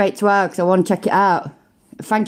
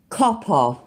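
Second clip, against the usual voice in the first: "Copper" said the British way, crisp and short; British pronunciation. Shown as a demonstration. Showing chat the British way to say it, crisp British. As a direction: give "Copper" its proper British sound. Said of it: "Who does it sound better from, me or him?".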